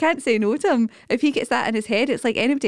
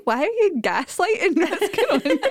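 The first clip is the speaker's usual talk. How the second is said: slow, higher, laughing throughout; laughing disbelief; 11 semitones higher, slower than usual. Retold or imitated. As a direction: ask it while cracking up. Mum to son, laughing.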